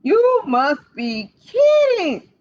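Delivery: high, slow, loud disbelief; Cat scoffing at the panic; doubting cat. Acted. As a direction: drag it out, not buying it.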